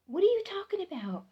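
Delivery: high, fast, startled question; retold astonishment. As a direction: blurt it out in disbelief. Shocked aunt questioning niece.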